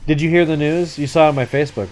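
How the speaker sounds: ordinary talk, nothing marked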